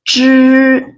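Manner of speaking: zhi with the tongue tip on the roof of the mouth, like ge in George; retroflex zhi. Shown as a demonstration. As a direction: curl the tongue back for zhi. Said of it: "like the letter G E in George".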